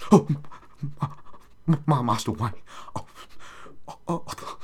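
breathy, fading Alfred voice trailing to whispered moans; Michael Caine as Alfred. Retold or imitated. Demonstrating his Alfred, trailing into whispered oh's. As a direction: do Alfred like he's in ecstasy. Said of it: "gotta sound like you're coming".

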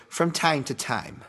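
lightly attempted Boston accent; poor Boston accent. Podcaster imitating Keith from time to time, poor Boston accent. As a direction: have a rough go at a Boston accent. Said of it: "my poor Boston accent".

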